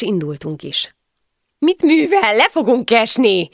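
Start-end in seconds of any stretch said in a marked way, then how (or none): 1.6-3.5 high, loud panicked shout; alarmed shouting. A narrator's reading. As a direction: yell out in alarm at the rider. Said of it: "kiabáltam". (rémült kiabálás)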